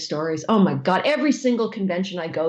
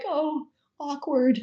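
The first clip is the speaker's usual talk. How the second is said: awkward discomfort; 6 semitones higher, 4 dB quieter, much slower than usual. Retold or imitated. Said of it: "And he's like".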